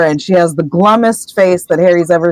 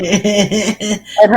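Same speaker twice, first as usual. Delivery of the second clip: laughing; 3 dB quieter than usual. Real, not acted. Laughing through narration.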